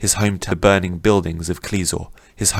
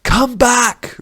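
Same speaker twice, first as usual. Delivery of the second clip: much higher, loud desperate yell; 6 dB louder than usual. Acted. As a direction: scream for him to return. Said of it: "Rin yelled".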